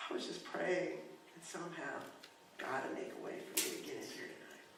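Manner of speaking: quiet, fading retold confession; subdued, tearful reply. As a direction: retell a humble prayer very softly. Weeping man answers, retold.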